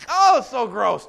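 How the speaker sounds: high, loud groan of disgust; hammed-up disgust. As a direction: recoil at the taste, overplayed. Retold disgust eating mussels.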